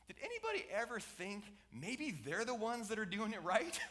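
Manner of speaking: usual speaking voice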